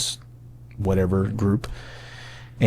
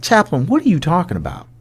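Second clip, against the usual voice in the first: higher-pitched, exasperated retold question; insistent bewilderment; 7 semitones higher than usual. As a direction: press the chaplain for an explanation. Repeated question, now more insistent.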